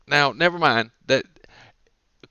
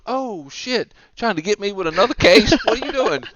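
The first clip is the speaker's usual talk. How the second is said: high-pitched indignant protest; mock alarmed thief; 8 semitones higher, 3 dB louder than usual. Car thief finding a kid in the back, mock alarm. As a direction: protest in a put-on panic.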